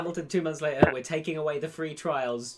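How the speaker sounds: playing Disney cashing in, lightly; mock Disney exec. Friend voicing Disney pulling free trials, mock smug boss.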